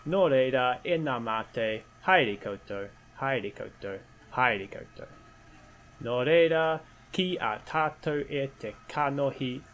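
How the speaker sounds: formal Māori greeting. Acted. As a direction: recite it like a formal welcome. Opening Māori mihi, formal.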